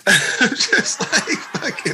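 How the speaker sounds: breathy laughing words. Real, not acted. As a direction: laugh through it. Laughing at memory.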